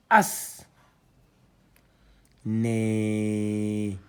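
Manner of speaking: loud, drawn-out syllables read one by one; syllable reading drill. Shown as a demonstration. Teacher spelling out syllables loudly for students. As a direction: sound out each letter for the class.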